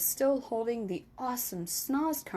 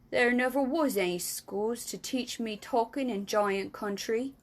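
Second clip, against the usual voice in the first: ordinary delivery, nothing marked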